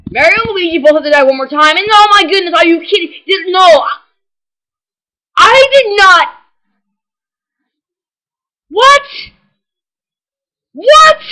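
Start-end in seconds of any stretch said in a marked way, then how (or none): 1.8-6.3 loud, high, can't believe it; shocked disbelief. Real, not acted. Loses the match unexpectedly. 8.7-11.2 high, loud shocked yelp; stunned what. Real, not acted. Loses the match unexpectedly.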